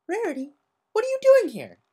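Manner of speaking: put-on startled child peering out from hiding; Scootaloo, sniffly and startled; teary Scootaloo.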